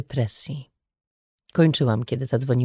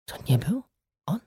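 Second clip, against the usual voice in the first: trembling voice; 7 dB quieter than usual. A narrator's reading. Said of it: "zapytała drżącym głosem".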